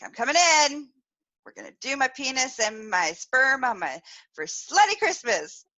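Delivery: high, loud, hyped-up declaration; gleeful announcement. Retold or imitated. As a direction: announce it like a party plan. Replays announcing her plan gleefully.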